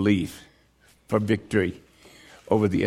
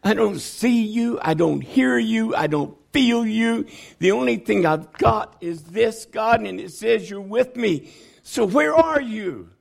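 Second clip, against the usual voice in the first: higher, pleading lament; desperate plea to God; 6 semitones higher than usual. Retold or imitated. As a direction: ask where God is, aching. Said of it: "That didn't stop me from saying".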